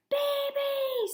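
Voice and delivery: a sudden high scream of her sister's name. A narrator's reading. Eugenia discovering her flowers are gone, screaming. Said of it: "screamed Eugenia".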